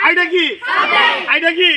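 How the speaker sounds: high, loud quizzing call; loud drill question. Shown as a demonstration. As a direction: fire the question out for a class response. Teacher drills pupils with repeated question. (উঁচু গলায় জোরে প্রশ্ন)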